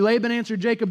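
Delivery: usual speaking voice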